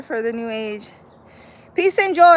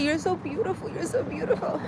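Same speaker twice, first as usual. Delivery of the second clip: imitating the psychic, softer and higher; 5 semitones higher, 7 dB quieter than usual. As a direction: coo it gently like the old psychic. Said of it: "she was like".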